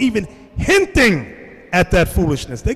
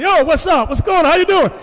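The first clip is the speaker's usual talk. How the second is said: high-pitched called-out greeting; calling back; 10 semitones higher, 3 dB louder than usual. Retold or imitated. Answering father-in-law from the car, high called-out greeting. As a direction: re-enact hollering hello across the street.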